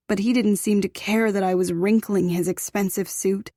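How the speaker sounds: ordinary delivery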